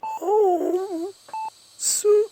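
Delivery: high, cracked moaning; feverish muttering. A narrator's reading. Dying patient muttering in his sleep, high and broken. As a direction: mumble through a fever dream. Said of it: "accompanied by more mutterings".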